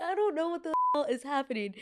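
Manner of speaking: high, strained disbelief; exasperated. Retold or imitated. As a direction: squeak it out in total confusion. Exasperated confusion at the door.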